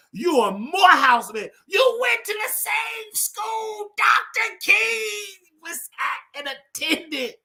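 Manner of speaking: fiery, slow preacher cadence; preaching. Acted. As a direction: preach it from the pulpit. Sermon-like lecture to Brother Oliver.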